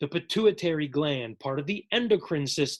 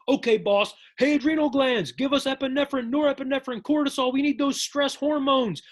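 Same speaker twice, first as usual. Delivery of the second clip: higher and louder, calling down the line; excited orders; 8 semitones higher, 4 dB louder than usual. Acted. Pituitary shouting orders to the adrenal glands, higher. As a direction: pass the boss's orders on with gusto.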